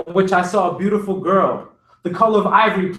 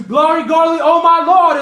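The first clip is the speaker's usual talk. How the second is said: high, louder preaching cry; rising cry of glory; 7 semitones higher, 5 dB louder than usual. A narrator's reading. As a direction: swell it up to the rafters. Preacher crying out in sermon.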